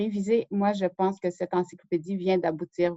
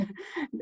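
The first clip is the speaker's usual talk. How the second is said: embarrassed little laugh; 7 semitones higher, 10 dB quieter than usual. Real, not acted. Laughs after tripping on a word.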